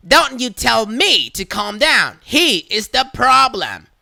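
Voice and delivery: loud, slow indignant snap; snapping Karen. Retold or imitated. Karen snapping at the worker, slow and indignant. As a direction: bite back at her.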